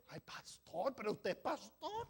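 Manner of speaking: ordinary talk, nothing marked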